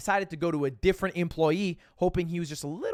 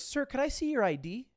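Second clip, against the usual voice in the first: higher, polite questioning lady voice with a lift at the end; imitating staff lady; 6 semitones higher, 4 dB quieter than usual. Casino worker politely asks the tired gambler for ID, light higher voice. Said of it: "And she goes".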